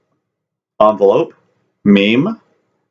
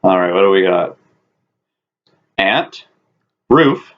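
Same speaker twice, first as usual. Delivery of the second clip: usual speaking voice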